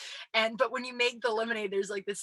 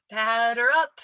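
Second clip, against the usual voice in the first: half-hearted umpire call; 2 semitones higher, 8 dB louder, slower than usual. Retold or imitated. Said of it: "not your best umpire voice".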